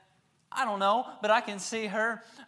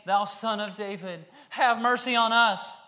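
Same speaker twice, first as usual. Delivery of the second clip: crying out; 4 semitones higher, 7 dB louder than usual. Acted. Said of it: "crying and saying".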